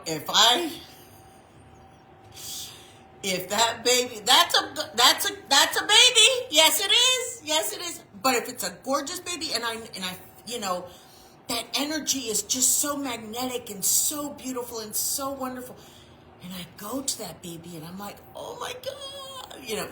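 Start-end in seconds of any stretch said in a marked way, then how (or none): 4.2-8.0 put-on high cooing baby talk. Cooing down at a gorgeous baby, in high baby talk. 18.3-19.6 very high, slow, soft gushing squeal. Retold or imitated. Preacher melting over a gorgeous baby, in a soft high squeal.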